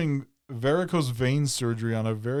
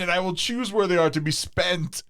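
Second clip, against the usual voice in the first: higher, pompous outraged noble; grand mock demand; 6 semitones higher, 4 dB louder than usual. Dazed patient demanding teeth from doctor, mock haughty. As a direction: demand your teeth back like a haughty lord.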